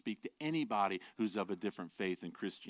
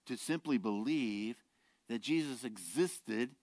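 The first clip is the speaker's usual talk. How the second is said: ordinary delivery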